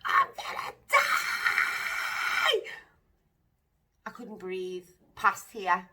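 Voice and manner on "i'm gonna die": high, screamed plea, far higher; retold scream of terror on the pool floor; panicked scream. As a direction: shriek it as if you're dying. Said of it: "I was screaming".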